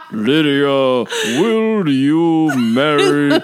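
hammed-up slow priest asking Lydia; stiff dead priest. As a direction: draw it out like a creepy undead priest. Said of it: "Remember he was like".

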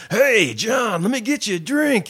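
high, loud mock old party animal; very excited old man. Old man greets the birthday man at his party, very excited. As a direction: holler it with hammed-up excitement. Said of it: "this other old man who's very excited".

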